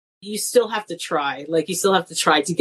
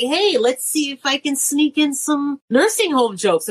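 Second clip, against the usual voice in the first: sly inner voice; 6 semitones higher than usual. Retold or imitated. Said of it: "and I'm like".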